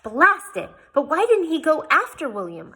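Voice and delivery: high, loud frantic question; wild outburst. A narrator's reading. Jane asks wildly why Yella did not follow William. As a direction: burst out in frustration. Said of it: "I asked wildly".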